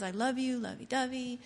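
mocking sappy poem. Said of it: "I don't want the poem that says".

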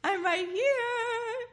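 high, loud, slow plea; mock whiny bride calling out. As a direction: wail after him to come back. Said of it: "You're like".

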